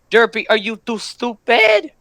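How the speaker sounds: normal talking voice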